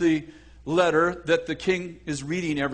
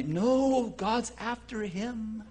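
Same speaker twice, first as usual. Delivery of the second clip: soft, drawn-out gloat; hushed revelation; 7 dB quieter, much slower than usual. Acted. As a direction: let it out slowly, barely voiced. Gleeful secret reversal of the chase.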